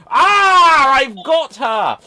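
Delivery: triumphant pantomime villain, loud and high; Hook. Acted. Villain seizes his captive, triumphant shout. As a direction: roar it slowly with mock menace. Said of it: "kidnapped by Hook".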